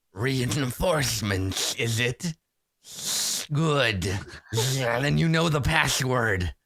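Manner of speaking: slow hiss through spider mandibles; hissing spider-faced drow. Acted. Spider-faced drow boss questions newcomers, with a slow hiss. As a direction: draw it out, hissing on the consonants. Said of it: "hisses at you".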